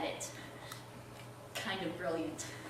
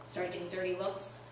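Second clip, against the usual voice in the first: ordinary delivery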